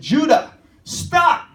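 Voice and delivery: loud high-pitched shout of warning; retold shout. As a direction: yell it to stop a child riding into danger. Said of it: "So I shout his voice".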